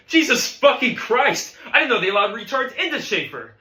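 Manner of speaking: high, loud yelling; enraged bandleader's outburst; furious tirade. Acted. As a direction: explode in exasperation at the student. Teacher berating drummer.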